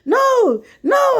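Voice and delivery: rising panic, backing away; panicked refusal. Retold or imitated. Scared nine-year-old refusing to kiss his dead dad, pitch climbing each no. Child fleeing the casket.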